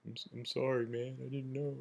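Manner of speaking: soft, crestfallen mumble; sad, sheepish apology. Retold or imitated. Puncher apologizing to his victim, soft and crestfallen. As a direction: imitate a remorseful guy going small and quiet. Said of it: "this really sad look on his face".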